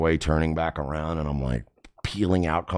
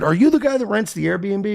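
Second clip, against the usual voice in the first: high-pitched, exasperated challenge; acted indignation; 12 semitones higher, 4 dB louder than usual. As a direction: retell it as a heated demand. Acted argument with the guy.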